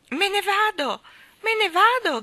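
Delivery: acuto e molto forte; grido di Jane. A narrator's reading. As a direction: grida volando via. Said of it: "gridò Jane". (Jane grida acuta e forte)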